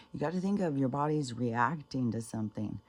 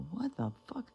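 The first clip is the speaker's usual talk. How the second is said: high-pitched, softer mock disbelief; imitated outraged disbelief; 11 semitones higher, 5 dB quieter than usual. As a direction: squeak it out like an offended mother. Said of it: "And she was so pissed".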